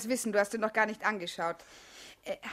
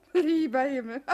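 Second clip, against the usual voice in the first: laughing out the words, slow and louder; amused laugh through the exclamation; 2 semitones higher, 6 dB louder than usual. Acted. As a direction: burst out laughing at the idea. Laughing at his reply.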